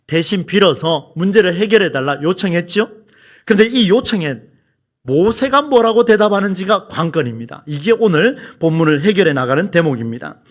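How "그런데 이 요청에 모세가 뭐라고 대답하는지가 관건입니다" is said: usual speaking voice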